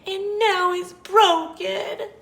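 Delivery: high, loud, slow squeak; Baby Bear's squeak. A narrator's reading. Baby Bear mourns his little chair, wailing squeak. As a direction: wail it slowly in a tiny squeak. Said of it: "he squeaked".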